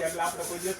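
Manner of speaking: normal talking voice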